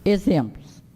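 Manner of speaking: ordinary talk, nothing marked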